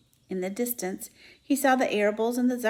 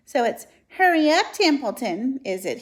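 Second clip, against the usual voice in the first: a lightly voiced mock Wilbur, higher and louder; mocking imitation; 4 semitones higher, 7 dB louder than usual. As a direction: mimic the pig's whine. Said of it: "He began imitating Wilbur's voice".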